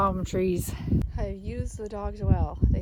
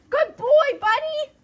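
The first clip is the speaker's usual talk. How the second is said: high cooing pet praise; talking to a dog; 7 dB louder, slower than usual. Real, not acted.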